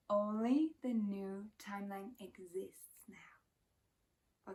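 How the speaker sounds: slow, soft whisper. Shown as a demonstration. Repeating the truth to viewers in a slow whisper. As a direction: breathe it slowly like a secret truth. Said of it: "repeats line, then whispers it".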